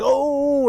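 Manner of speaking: high, drawn-out "ooh"; imitated crowd cheer. Teammates throwing up their hands at practice, imitated cheer. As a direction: mimic teammates whooping together. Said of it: "and they're like".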